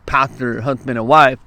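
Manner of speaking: ordinary delivery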